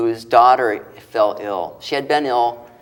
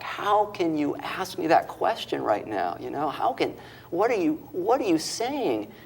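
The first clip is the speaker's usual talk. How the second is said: higher-pitched, softly retold distressed protest; distraught disbelief; 6 semitones higher, 5 dB quieter than usual. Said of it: "she's just distraught".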